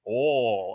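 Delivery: "oh" as a slow, mushed awl; drawn-out "oh" with no oy glide; Southern oil heard as "oh"; imitated Southern oil. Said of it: "I don't hear the oi".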